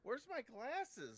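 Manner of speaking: high, slow and groggy; dazed after a beating. Acted. Rick just revived asking for his glasses.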